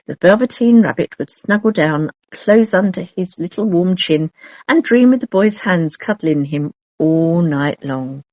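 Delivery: ordinary delivery, nothing marked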